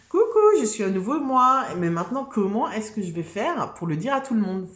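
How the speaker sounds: high, loud bubbly hello as a brand-new me; excited new self. Pretend. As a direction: burst in like a reborn self greeting everyone. New self announcing itself, acted.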